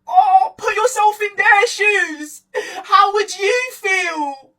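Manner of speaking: slow, drawn-out whiny teacher voice; mock pleading teacher. Teacher lecturing class on cyberbullying, slow mock pleading. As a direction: plead it slowly, as a mocked teacher. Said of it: "my teacher used to be like".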